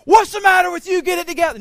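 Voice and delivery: shouted high and loud, a scolding father; mock angry scolding. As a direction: bark it like an impatient dad. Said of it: "No, it says that".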